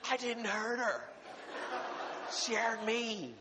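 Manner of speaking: high, laughing mock defense; laughing to his wife that he is innocent; laughing protest. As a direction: plead innocence while laughing.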